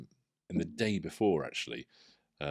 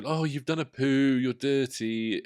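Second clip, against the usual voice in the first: parent at nappy change; 6 semitones higher, 5 dB louder, slower than usual. Retold or imitated.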